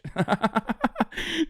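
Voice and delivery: high-pitched, put-on gloating cackle; mocking laugh. Streamer imitating a gloating chatter, mocking laugh. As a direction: laugh like a smug commenter who thinks he won.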